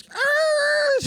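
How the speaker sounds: a sudden high startled shout; startled yell. Retold or imitated. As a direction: yelp like you're scared someone's robbing you. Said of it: "He looks at the thing and goes".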